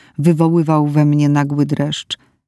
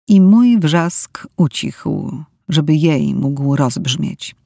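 plain reading voice

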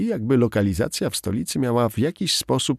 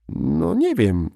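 slow, careful hesitation; cautious; 7 semitones higher, much slower than usual. A narrator's reading. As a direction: stretch it out warily. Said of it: "zaczęła ostrożnie".

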